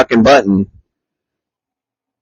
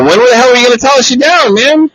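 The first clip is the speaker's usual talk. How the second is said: higher, fast frustrated rant; exasperated; 12 semitones higher than usual. Retold or imitated. Teammate scolding friend for late callout, exasperated. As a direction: throw your hands up at the late news.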